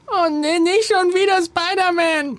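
put-on very high, whiny young daughter; little girl voice. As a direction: squeak it out like a bored girl. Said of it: "Ich bin die Tochter".

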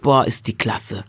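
put-on hype, drawn out at the end; mock admirers. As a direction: imitate others' hype with a touch of mockery. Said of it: "Weil alle Weißen gesagt haben".

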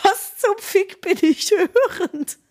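can't believe what you're hearing; stunned inner voice. Retold or imitated. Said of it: "Und ich denk so".

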